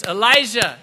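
loud, sharp call of the name. Acted. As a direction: call out to get his attention. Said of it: "'Cause he was saying this".

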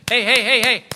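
loud, high, rapid-fire calls; attention-grabbing call. Acted. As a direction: break in to get their attention. God calling for attention.

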